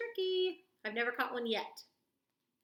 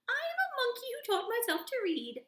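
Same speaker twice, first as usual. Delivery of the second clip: higher, louder silly monkey voice; 10 semitones higher, 5 dB louder than usual. Acted. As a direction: play a goofy monkey reading aloud. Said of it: "my monkey voice".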